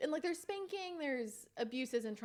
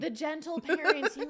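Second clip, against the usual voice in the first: mocking; 6 semitones higher, 9 dB louder than usual. Real, not acted. Mocking the gentle parenting trend.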